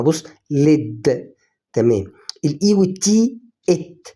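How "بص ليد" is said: ليد said very slowly, clear l, short e, d; slow word demo. Shown as a demonstration. Teacher modelling ليد for beginners, very slowly. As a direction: stretch out ليد so each sound is heard. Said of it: "زي اللام".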